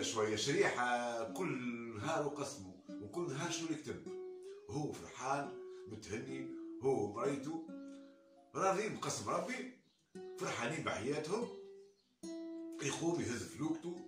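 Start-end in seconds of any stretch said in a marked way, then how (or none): none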